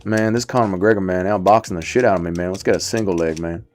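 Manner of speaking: put-on hollow American accent, low and soft; Sean Brady. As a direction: imitate a regular American guy, hollow and subdued. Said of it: "hollow American voice".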